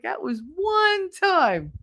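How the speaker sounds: high, drawn-out protest; retold defensive insistence; indignant insistence. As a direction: stretch ONE out high in mock outrage. Voicing defensive indignant reply.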